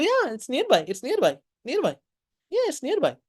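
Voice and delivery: high, careless brush-off; dismissive high voice. Acted. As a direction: wave her away lightly. People brushing her off.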